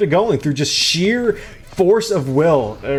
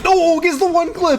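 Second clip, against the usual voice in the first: much higher, a sudden burst of caster hype; excited shriek. Real, not acted. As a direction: jump up in pitch at the play. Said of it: "I like the way you said that".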